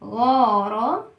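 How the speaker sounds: غارة drawn out very slowly; teacher blending غارة slowly. Shown as a demonstration. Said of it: "تعالى نقرأ تلات أصوات".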